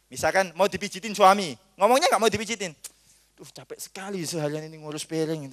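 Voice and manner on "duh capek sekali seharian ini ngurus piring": soft, tired hinting complaint; weary mock wife. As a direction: sigh it softly as a hint for sympathy. Wife hints she is tired. (keluhan istri pura-pura capek, pelan)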